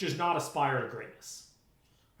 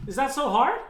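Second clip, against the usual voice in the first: louder, higher, exasperated rant; 7 semitones higher, 6 dB louder than usual. Real, not acted. Ranting at emailers, loud and exasperated. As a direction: demand it, fed up and incredulous.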